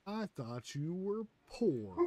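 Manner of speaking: very slow, high, swooping mock taunt; Damon Darling catchphrase. Streamer mimics a prankster's catchphrase, hammed-up mocking drawl. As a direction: exaggerate every word of the prankster's punchline. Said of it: "the way he says it".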